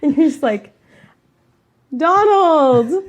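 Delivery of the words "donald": raised, higher-pitched call. Acted. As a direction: call the name out loud, pitched up. Player calls out to Donald.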